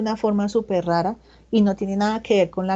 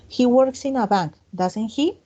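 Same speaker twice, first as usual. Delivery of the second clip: "doesn't he" lifted slightly at the end, to confirm; rising tag question; 3 semitones higher, slower than usual. Shown as a demonstration. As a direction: say the statement, then let "doesn't he?" rise a little. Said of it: "el tono de voz sube un poquito". (entonación que sube un poquito en "doesn't he?")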